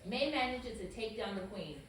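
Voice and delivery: usual speaking voice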